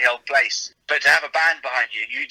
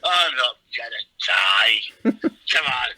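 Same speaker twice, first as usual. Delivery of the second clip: cocky bravado; slower than usual. Retold or imitated. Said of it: "I'm giving it all this".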